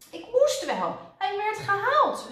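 high, loud defensive protest; a flustered nurse excusing herself; crying out. A narrator's reading. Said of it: "riep zuster Snijwiet". (verdedigend uitroepend)

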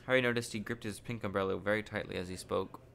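ordinary delivery, nothing marked

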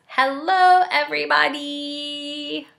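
high, slow furry monster hello; Grover voice. Acted. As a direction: greet them as lovable Grover. Grover greets the reader.

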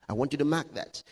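usual speaking voice

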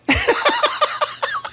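high, breathy burst of laughter. Real, not acted. Streamer laughing after the beatdown, high laugh. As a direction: laugh at your own frustration.

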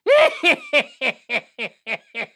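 long, rapid villain cackle; evil cackle. Acted. Killer doll chasing its victim, gleeful evil cackle.

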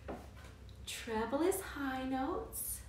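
ordinary delivery

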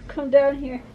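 ordinary talk

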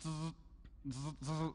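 quick soft buzzes with gaps; imitated machine buzz. Preacher showing how the claw moves, imitated buzz. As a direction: mimic a claw motor jerking along. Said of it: "here's how it goes".

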